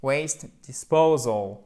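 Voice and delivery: slow "Waste disposal", stress on PO; accent tonique. Shown as a demonstration. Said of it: "Accent tonique". (Waste disposal lentement, accent sur PO)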